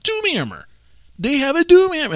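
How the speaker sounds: high thrilled Doom Hammer; excited realization. Retold or imitated. Excited recognition.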